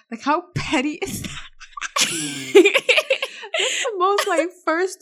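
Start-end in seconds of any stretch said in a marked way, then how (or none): 1.5-3.5 high-pitched burst of laughter. Real, not acted. Laughing at friend.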